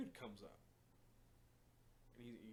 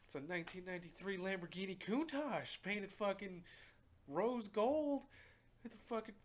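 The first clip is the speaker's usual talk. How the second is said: high, loud, overexcited rave; excited car gushing; 11 semitones higher, 12 dB louder than usual. Retold or imitated. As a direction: gush at full pitch about a flashy car. Acting out someone gushing about a car.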